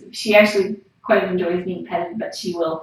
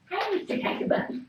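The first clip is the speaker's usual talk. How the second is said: cooing pet talk; 13 semitones higher, 6 dB quieter than usual. Real, not acted. Baby talk to the cat.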